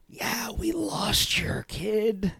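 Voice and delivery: slow, sheepish whisper; whispered confession. Retold or imitated. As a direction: breathe it out like a guilty secret. Awkward confession quoted.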